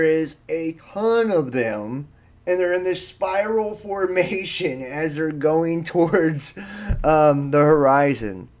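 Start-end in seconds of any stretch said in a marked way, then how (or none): none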